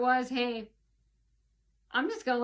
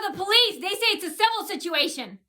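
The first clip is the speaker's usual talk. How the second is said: higher, louder, insistent; retold loud answer; 6 semitones higher, 4 dB louder than usual. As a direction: hit it back, raised and high. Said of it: "I go".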